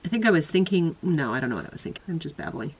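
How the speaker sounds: ordinary delivery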